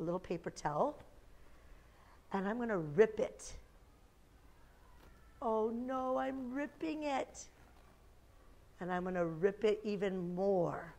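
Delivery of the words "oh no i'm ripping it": soft, slow mock worry; feigned dismay. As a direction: play dismayed, stretching it out. Magic trick, feigned dismay.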